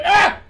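loud high gasp of pain; grandpa heart-attack gasp. Retold or imitated. Grandpa grabbing his chest at dinner, loud pained gasp. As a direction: clutch your chest and cry out. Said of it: "And he goes".

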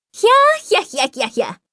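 put-on high shrieking old man cackle; cackling laugh. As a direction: let out a wild, piercing cackle. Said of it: "特徴のある笑い声が響いてきた".

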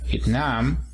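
"Vietnam" in English, viet-NAM; stress on second syllable. Shown as a demonstration. Teacher models "Vietnam" after the rule, stress on second syllable. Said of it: "El acento en la segunda sílaba".

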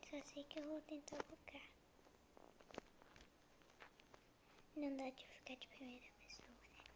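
hushed whisper to the mic. Real, not acted. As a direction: whisper it quietly. Whispering while playing alone.

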